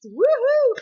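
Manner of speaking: high, loud whoop of joy; woohoo. Real, not acted. Fan celebrating her team's ranking with a loud whoop. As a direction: let it burst out.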